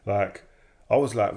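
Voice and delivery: ordinary talk, nothing marked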